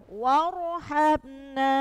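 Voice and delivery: warhabna very slow, ro read thick and full; heavy ro. Shown as a demonstration. Teacher drills students on a heavy ro, slow demo.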